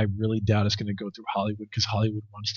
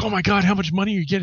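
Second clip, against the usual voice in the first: high, fast excited burst as the father; excited dad; 10 semitones higher, much faster than usual. Retold or imitated. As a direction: gush it out like a thrilled dad. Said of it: "my dad calls me up just so excited".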